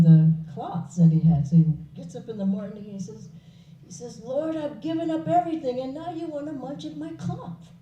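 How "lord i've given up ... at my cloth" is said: mock complaining renunciate.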